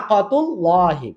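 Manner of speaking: কতুল্লাহি slow and soft with a thick L; slow recited কতুল্লাহি; heavy L. Shown as a demonstration. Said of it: "পেশ help করেছে".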